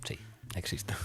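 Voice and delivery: whispered aside, breath only; stage whisper. Acted. Host whispering a sly confirmation to co-hosts, whispered. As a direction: drop to a conspiratorial whisper. Mock secret answer to own question.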